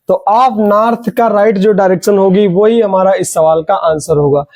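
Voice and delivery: normal talking voice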